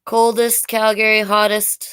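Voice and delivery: plain reading voice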